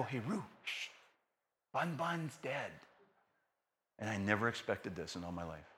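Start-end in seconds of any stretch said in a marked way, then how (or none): 0.0-2.7 soft, slow hushed telling; hushed bad news. Father quietly tells daughter the rabbit died, hushed. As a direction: break the news gently under the breath.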